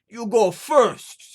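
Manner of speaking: put-on a giant bossing the boy; giant's command. Giant orders the lad.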